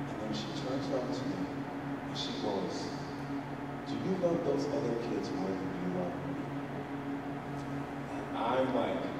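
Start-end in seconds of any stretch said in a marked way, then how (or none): 3.9-6.4 very soft, low question; hushed, vulnerable retelling; hurt child. Retold or imitated. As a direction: imitate a child asking timidly. Crying child's question, acted.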